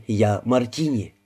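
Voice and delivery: ordinary delivery, nothing marked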